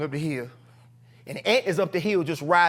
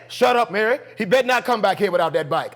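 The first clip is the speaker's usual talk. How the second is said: loud, stern snap back; forceful retold warning; dad's voice; 3 semitones higher, 4 dB louder than usual. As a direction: imitate a father cutting off his wife. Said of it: "I hear this behind the door".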